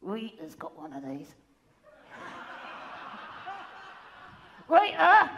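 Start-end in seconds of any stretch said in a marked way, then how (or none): 4.7-5.3 loud high call across room. Acted. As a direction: shout her name to reach her. Calling out to Rita.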